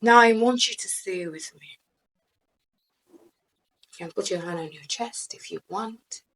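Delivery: guided meditation. Acted. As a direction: lull them into stillness. Guided breathing and grounding.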